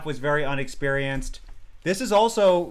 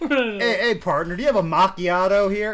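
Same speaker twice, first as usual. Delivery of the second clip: mock cowboy; 5 semitones higher, 5 dB louder than usual. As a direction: play a cowboy ordering coffee, half laughing. Cowboy bit about ordering macchiato.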